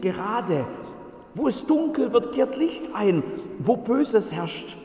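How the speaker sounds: hoch, eindringlich verkündend; begeisterter Bote. Acted. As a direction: die Verheißung mit Feuer ausmalen. Bote verkündet das Heil. (Bote predigt begeistert)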